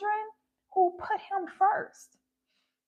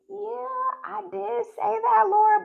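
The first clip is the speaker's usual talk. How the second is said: sheepish admission; 2 semitones higher, 4 dB louder, slower than usual. Retold or imitated. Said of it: "And I'm like".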